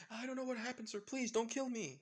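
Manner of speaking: high, loud pleading yell; frightened yelling. Acted. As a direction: beg for your life at the top of your voice. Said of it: "With the guy yelling out".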